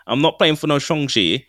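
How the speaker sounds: ordinary talk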